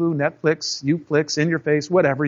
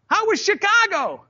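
much higher, bright mock greeting; chummy tower voice; 14 semitones higher, slower than usual. Control tower teasing the returning pilot, high cheery greeting. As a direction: sing out a jokey hello, pitched way up. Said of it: "the control tower goes".

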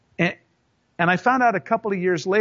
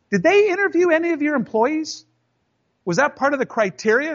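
raised-pitch pointed challenge to the boss; pointed question; 9 semitones higher than usual. Retold or imitated. Employee confronting his boss about the award, high and needling. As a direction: ask it high and needling, like a trap. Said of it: "and I go".

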